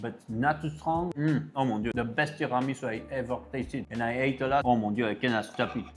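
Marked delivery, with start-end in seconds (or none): none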